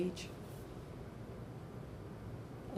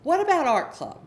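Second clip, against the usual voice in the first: higher, louder hopeful suggestion; eager parent; 9 semitones higher, 6 dB louder than usual. Retold or imitated. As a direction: pitch it up like a parent selling an idea.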